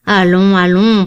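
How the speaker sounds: protesting. A narrator's reading. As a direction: push back at the old man, insistent. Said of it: "protesta Lord Warburton".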